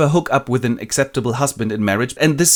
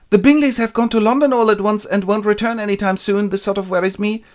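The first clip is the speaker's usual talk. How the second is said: worried Jane; 11 semitones higher, 4 dB louder than usual. Retold or imitated. Jane fretting over the news, higher voice. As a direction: fret about Bingley leaving. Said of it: "Jane gets the news".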